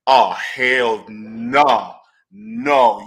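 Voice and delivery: real firm hell no, slow and loud; emphatic refusal. Real, not acted. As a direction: plant your feet and refuse it. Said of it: "do not let me be standing next to you".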